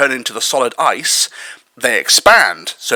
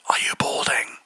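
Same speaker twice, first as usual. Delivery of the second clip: whispering; 9 dB quieter than usual. Pretend. Teasing aside about the man.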